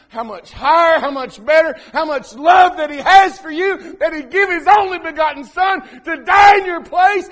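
rising to a full shout; preaching shout. Real, not acted. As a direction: build it up into a big preaching shout. Preacher building to a climax.

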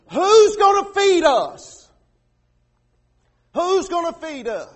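mock complaining shout. Preacher voicing Israelites' complaint with a mock loud whine. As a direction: yell it like a grumbling crowd. People complaining voiced in sermon.

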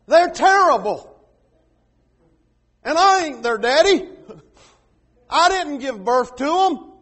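loud exasperated moaning complaint, half-joking; hammed-up woe, pleading to be done with it; mock despairing Moses. Preacher acting Moses fed up with his people, loudly.

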